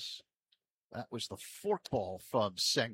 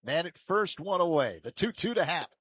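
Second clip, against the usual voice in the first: raised, projected setup with stretched result; live play-by-play; 4 semitones higher, 6 dB louder than usual. Real, not acted. Announcer calling the two-two pitch, live play-by-play. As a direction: punch out the situation in short bursts.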